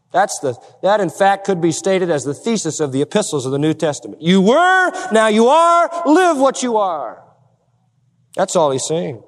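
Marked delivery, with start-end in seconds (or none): 4.2-7.2 high, loud stress on "were" and "are"; ringing contrast of "were" against "are"; emphatic preaching. Real, not acted. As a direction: punch "were", then "are", then "live". Preacher repeats his slogan forcefully.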